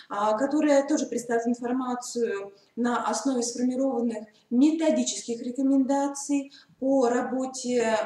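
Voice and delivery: ordinary talk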